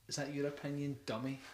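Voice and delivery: softly sneering quote; quiet sneer. Retold or imitated. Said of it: "typical of that attitude".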